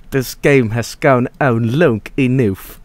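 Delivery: slow imitated bad British accent; Dennis. Streamer previewing the blooper line, slow bad British accent. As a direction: stretch each vowel in mock British. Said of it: "his delivering the blooper one".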